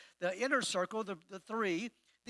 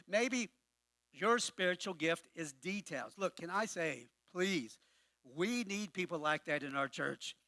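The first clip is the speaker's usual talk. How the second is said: ordinary talk, nothing marked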